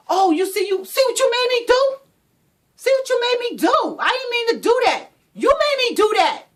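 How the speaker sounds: high, loud accusing yell; blaming abuser. Acted. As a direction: shove the blame onto her. Abuser blaming after hitting.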